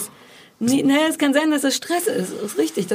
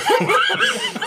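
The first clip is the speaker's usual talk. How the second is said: high shrieking laugh. Real, not acted.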